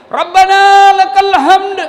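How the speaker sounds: high, loud, slow called response; relayer's loud call. Retold or imitated. Muballigh answering the imam, high loud call. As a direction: ring it out to the congregation.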